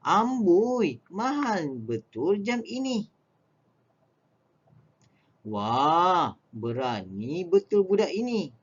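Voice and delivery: high, slow amazement at a price; surprised amboi, pitched high; amazed. Shown as a demonstration. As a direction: marvel at how dear it is. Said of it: "menyatakan perasaan hairan".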